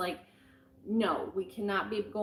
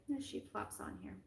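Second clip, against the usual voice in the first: whisper; 6 semitones higher, 11 dB quieter than usual. Real, not acted. Aside about the cat settling.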